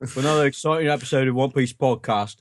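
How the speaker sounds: lightly voiced British continuity announcer. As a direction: announce the next episode. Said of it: "Stay tuned next week".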